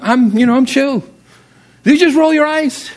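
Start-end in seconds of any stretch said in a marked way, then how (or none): 1.8-2.9 higher, startled retold question; pitched-up disbelief. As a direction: squeak it out like a shocked pastor. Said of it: "I maybe had a little high voice there".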